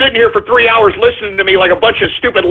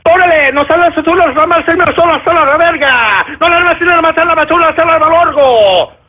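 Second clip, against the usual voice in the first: higher-pitched rapid-fire Spanish outburst; sudden alternate personality; 7 semitones higher, faster than usual. Shown as a demonstration. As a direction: burst into a put-on Spanish-speaking character. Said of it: "talking to you like this and then".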